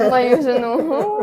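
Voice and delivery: slow, loud correction through laughter; laughing correction. Real, not acted. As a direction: correct him, amused and laughing. Laughingly corrects his Russian grammar.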